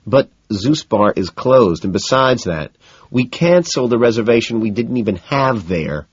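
strained, deliberate patience; explain, keeping exasperation in check; straining for calm. A narrator's reading. McDermott corrects his friends about the bar, straining for calm. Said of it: "trying to stay calm".

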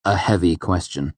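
ordinary delivery

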